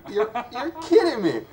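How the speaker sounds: stammering amazed protest; incredulous. Retold or imitated. Hearing about the drying paint watcher job, incredulous.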